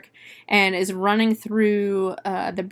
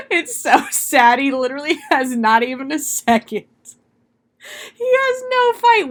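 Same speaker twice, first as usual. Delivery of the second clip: laughing pity; 6 semitones higher, 6 dB louder than usual. Real, not acted. Mourning the crew member through laughter, high cry. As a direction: wail it through laughter, mock-tragic.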